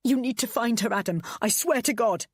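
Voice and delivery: desperate threat. A narrator's reading. Pleading threat.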